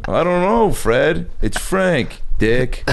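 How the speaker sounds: cocky New York comic; New York accent. Pretend. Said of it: "Andrew Dice Clay does it".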